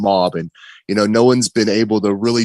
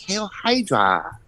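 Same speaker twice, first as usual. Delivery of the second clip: slow, high, drawn-out Hail Hydra in a put-on accent; mock Hail Hydra; 9 semitones higher, 3 dB quieter, slower than usual. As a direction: savour Hail Hydra like a villain. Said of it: "the whole Chinese voice".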